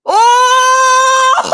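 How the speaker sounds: high, loud delighted yelling; gleeful shout. Real, not acted. Fan mocking a rival defender's error, gleeful shout. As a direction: scream with glee at the blunder.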